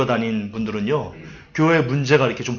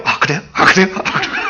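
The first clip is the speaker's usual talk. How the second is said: mock overeager replies; 6 semitones higher than usual. Shown as a demonstration. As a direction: parody a counselor who agrees too fast, cracking up at the end. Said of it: "제가 그러면 여러분도 얼마나 불안하겠어요".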